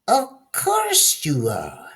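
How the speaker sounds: high, slow witch voice. Acted. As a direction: purr it like a sly witch. Witch voiced in fairy tale.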